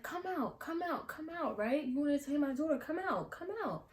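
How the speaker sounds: high mocking mom call, come out. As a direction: taunt the girl to step outside. Mom voiced calling girl out.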